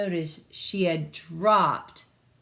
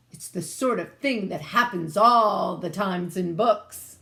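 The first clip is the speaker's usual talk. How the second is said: king's voice; 7 dB louder than usual. Acted. King explains the curse.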